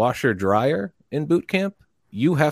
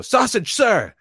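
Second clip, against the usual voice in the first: much higher, shouted recruit's answer; recruit sound-off; 14 semitones higher, 3 dB louder, slower than usual. Retold or imitated. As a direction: yell it up to the drill instructor. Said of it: "and I go".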